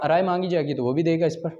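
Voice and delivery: usual speaking voice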